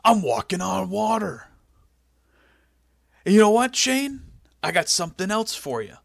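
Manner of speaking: high, showboating mock boss voice; Vince McMahon. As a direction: boast like a hammed-up wrestling boss. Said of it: "And he goes".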